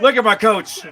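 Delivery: loud, high, proud shout; excited cheer. Real, not acted. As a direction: cry out, thrilled by your coach. Cheering coach seen in played clip.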